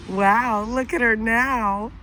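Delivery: ordinary delivery, nothing marked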